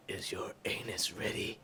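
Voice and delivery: a low, menacing whisper; creepy whisper. Acted. Killer's menacing quiet line.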